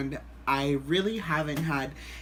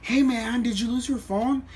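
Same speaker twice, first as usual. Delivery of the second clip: higher-pitched voice imitating a stranger; stranger at festival; 6 semitones higher than usual. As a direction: call over like a casual bystander. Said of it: "And then this guy comes up".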